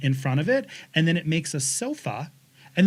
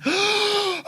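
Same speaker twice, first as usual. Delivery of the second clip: gasp. Retold or imitated. Discovering the sky couch.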